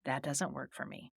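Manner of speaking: quiet, composed refusal; calm. Shown as a demonstration. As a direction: say it calmly and quietly. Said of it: "You can calmly say".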